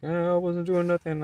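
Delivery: high put-on whiny voice of the guy; mock him as a whining liar; imitating a high whiny denial. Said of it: "He's like".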